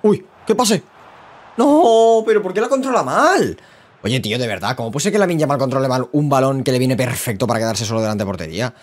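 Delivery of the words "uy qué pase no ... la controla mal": high-pitched, loud cry of dismay; real exasperated cry. Real, not acted. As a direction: yell in disbelief at a botched chance. Reacting live to a missed chance.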